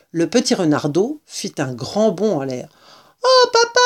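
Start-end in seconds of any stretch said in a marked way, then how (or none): 3.2-3.8 put-on very high child's cry; exclaiming cub. A narrator's reading. Fox cub calling his papa, high excited cry. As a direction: squeal it like an excited little one. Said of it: "s'écria-t-il".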